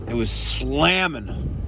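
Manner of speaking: slow, drawn-out relish; savoring. Real, not acted. Remembering the grilled cheese, slow relish. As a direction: stretch out the word slamming.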